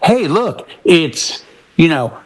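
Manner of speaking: normal talking voice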